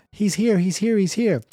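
high, repeated cry of alarm; high-pitched pretend panic; mock screaming. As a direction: imitate a panicked woman raising the alarm. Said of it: "She runs screaming".

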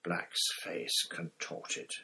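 ordinary delivery

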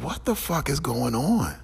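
high-pitched, incredulous self-quote; retold bewilderment. Quotes own bewildered reaction to incident.

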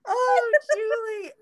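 slow, high, laughing cry of a line; crying with laughter. Real, not acted. Host laughs helplessly at her friend's noodle story. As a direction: laugh till the tears come. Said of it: "I'm crying".

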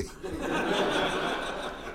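soft breathy chuckle; real laugh at the twist; quiet laugh. Real, not acted. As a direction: laugh under your breath. Laughs at own story.

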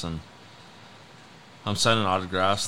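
ordinary delivery

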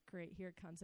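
a soft, dreading aside. Retold or imitated. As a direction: brace for the bad news under your breath. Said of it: "I'm like".

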